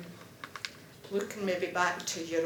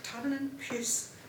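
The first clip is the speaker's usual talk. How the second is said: lively Scots verse read aloud to a class; bouncy storytelling recitation; poem recitation; 5 semitones higher, 4 dB louder than usual. Acted. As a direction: perform the ballad with relish, chuckling at the funny bits.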